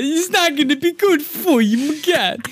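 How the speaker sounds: high, worried sidekick; high Boo Boo voice. Retold or imitated. Sidekick warns Yogi, high and worried.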